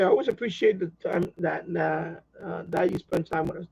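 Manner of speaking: ordinary talk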